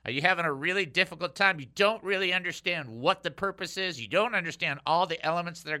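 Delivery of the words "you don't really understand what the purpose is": normal talking voice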